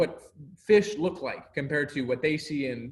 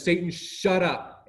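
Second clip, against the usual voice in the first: slow, higher, forceful quoted command; commanding, drawn-out rebuke; aggressive rebuke; 4 semitones higher, 4 dB louder, slower than usual. Retold or imitated. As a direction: act out a firm rebuke, word by word. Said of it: "It's a very aggressive word".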